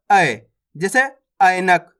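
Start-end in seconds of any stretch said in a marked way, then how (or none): 0.1-0.4 "आय" pushed as a gliding "a-e"; stressed ai diphthong. Shown as a demonstration. Teacher models the vowel, "आय" stressed and gliding. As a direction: lean on "आय", gliding from a to e. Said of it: "थोड़ा जोर लगाएंगे".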